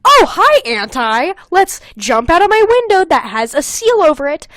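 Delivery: hammed-up loud cheerful hi; loudly mock the sneaking out; high, loud mocking chirp; mock loud greeting. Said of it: "she would definitely hear".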